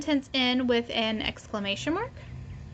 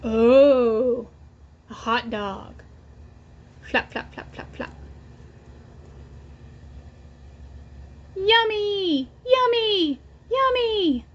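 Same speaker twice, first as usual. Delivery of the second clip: put-on reading to children; 5 dB quieter than usual. Teacher reading the Pigeon book aloud to her class. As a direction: read it out for little ones, pausing for them to echo.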